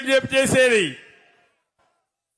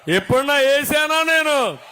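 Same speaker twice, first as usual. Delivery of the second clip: normal talking voice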